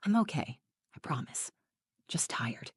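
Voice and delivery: hushed, near-whispered reassurance. A narrator's reading. Daughter found crying in the bathroom reassures her mother, whispered. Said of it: "she just whispered".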